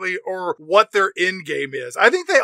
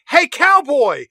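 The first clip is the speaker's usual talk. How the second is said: high, raised call to a stranger; 9 semitones higher, 4 dB louder, slower than usual. Acted. Photographer calling to a man she recognizes, high mock holler. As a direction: holler it up high to get his attention. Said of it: "and she's like".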